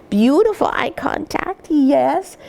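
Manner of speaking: lifted, sing-song praise for a puppy; high puppy praise voice. Shown as a demonstration. Breeder coos praise to a puppy, high sing-song. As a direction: coo it up high and bright to the pup. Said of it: "change my voice a bit".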